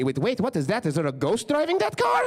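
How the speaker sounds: imitating a baffled dad, pitch jumping up high; the dad gaping at an empty running car; confused dad. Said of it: "It, like, confused him".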